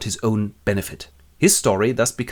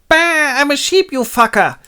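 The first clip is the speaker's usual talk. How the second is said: high, loud bleating goat voice; a goat cursing at the hunter; angry goat; 6 dB louder than usual. Acted. Goat shouting at Robinson after being shot, high and loud.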